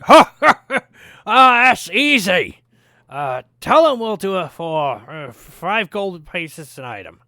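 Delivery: delighted dwarf brother cackling; booming laugh. Acted. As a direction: laugh it off as easy work. Other dwarf laughs at easy job.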